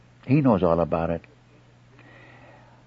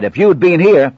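louder, higher accusing outburst; acted reproach; 8 semitones higher, 8 dB louder than usual. Martha to Jesus after her brother's death, reproachful. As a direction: blame him loudly for arriving late.

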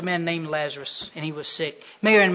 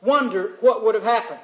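normal talking voice

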